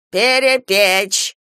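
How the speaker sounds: loud, drawn-out growl; roared command. A narrator's reading. Old woman shoving Kira away, roaring. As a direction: roar the word out, slow and forceful. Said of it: "рыкнула старуха".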